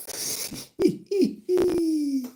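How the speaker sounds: squealing high laugh; helpless high giggling; high-pitched giggle. Real, not acted. Cracks up at own sweetheart line.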